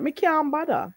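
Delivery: Patois phrase said lightly; Jamaican Patois. Shown as a demonstration. Teacher reminding learner of the Patois phrase. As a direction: give the Patois version.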